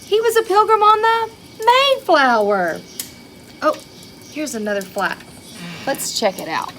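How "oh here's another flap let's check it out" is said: plain reading voice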